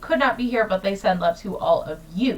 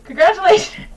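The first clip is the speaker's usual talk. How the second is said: excited; 9 semitones higher, 7 dB louder, faster than usual. Real, not acted. Host cheering the audience.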